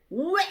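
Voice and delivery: short mock "uwek" of throwing up; imitated retch. Fish spitting Jonah onto land, mock retching noise. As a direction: spit it out with a cartoon retch.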